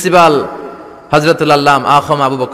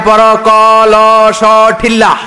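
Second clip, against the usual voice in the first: slow, loud, higher Chittagong schoolteacher drawl; Chittagong teacher; 6 semitones higher, 6 dB louder, much slower than usual. Retold or imitated. Chittagong teacher spells for pupils, slow loud Chittagong drill. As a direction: spell it out like a Chittagong village teacher, slowly and loudly. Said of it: "চট্টগ্রামে কলস রে কয় ঠিল্লা".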